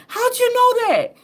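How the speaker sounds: high astonished question; amazed question. Retold or imitated. People amazed at her knowing, high astonished question. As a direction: squeak it out in disbelief. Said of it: "People will say to me".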